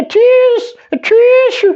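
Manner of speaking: high, loud sneeze sound on tish; mock sneeze. A narrator's reading. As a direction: burst out in a fake sneeze. Mimicking a sneeze.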